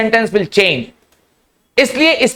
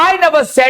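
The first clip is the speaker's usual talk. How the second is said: "I" lifted high and emphasised; stress on I; 4 semitones higher, much slower than usual. Shown as a demonstration. Teacher explaining who did the saying, stress on "I". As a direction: hit "I" to mean someone else said it. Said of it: "इसका मतलब मैंने कभी नहीं कहा".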